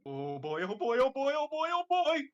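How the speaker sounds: building giddy excitement; excited. Acted. Luigi excited over his mail.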